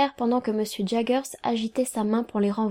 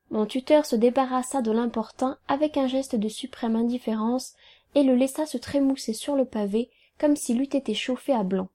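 ordinary delivery, nothing marked

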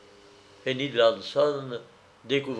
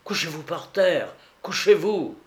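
higher, urgent command; shouted warning; 5 semitones higher than usual. A narrator's reading. Narrator to companion under gunfire, urgent cry. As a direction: call out an urgent order. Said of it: "criai-je".

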